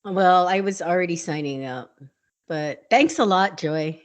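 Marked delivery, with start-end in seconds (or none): none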